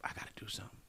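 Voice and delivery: hushed unvoiced whisper to himself; whispered thought. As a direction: breathe it out as a private worry. Whispered self-talk after failed scholarship.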